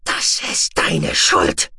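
slow, low, breathy hiss of blame. Acted. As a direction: hiss the accusation slowly. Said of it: "zischte er".

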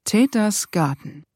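ordinary delivery, nothing marked

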